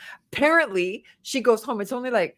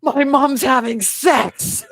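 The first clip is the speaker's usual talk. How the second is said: slow stunned announcement; 3 semitones higher, 6 dB louder, slower than usual. Retold or imitated. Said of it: "she's like".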